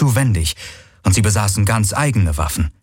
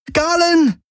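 sharp high cry of a name, much higher; sudden scream. A narrator's reading. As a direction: yell his name in alarm. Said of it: "schrie Kirai plötzlich".

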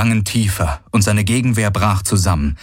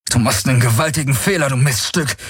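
fast, higher, snarled warning; growled threat; 6 semitones higher, faster than usual. A narrator's reading. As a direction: Galen snarls at Sharati. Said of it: "knurrte Galen". (knurrend, schnell, höher)

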